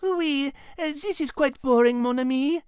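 put-on lightly French-accented bored remark; a hint of a French accent, bored; bored French Liz. Liz's French voice.

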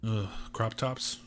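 slow, soft, disgusted groan; annoyed ugh. Real, not acted. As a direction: drag out a fed-up ugh. Disgust at girls' clothing.

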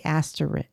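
ASTERISK ending in "rick", no s; own pronunciation. Shown as a demonstration. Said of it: "And I say asterisk".

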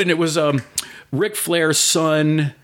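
normal talking voice

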